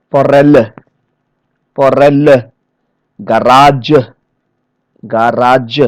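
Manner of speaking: "Forelle, garage, cassette, cuisine" with the middle syllable held; second-last-syllable stress. Shown as a demonstration. Trainer showing loan words ending in E, second-last-syllable stress. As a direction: punch RELL in "Forelle", RA in "garage", SET in "cassette". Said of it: "stress the second last syllable".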